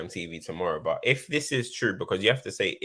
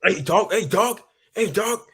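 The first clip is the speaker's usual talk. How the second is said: mock peacemaker, much higher and louder, calling out; imitating Lukaku breaking up a fight; 12 semitones higher, 5 dB louder than usual. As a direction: playfully yell hey dog to split them up, pitched up.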